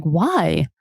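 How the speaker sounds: ordinary talk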